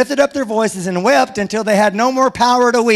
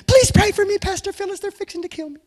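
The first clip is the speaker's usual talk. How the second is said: mock panicked plea; 9 semitones higher, faster than usual. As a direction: beg for prayer in hammed-up fright. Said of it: "I would start texting".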